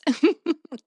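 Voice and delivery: quick loud chuckle; a real short laugh. Real, not acted. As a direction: laugh at your own joke. Host laughs at song description.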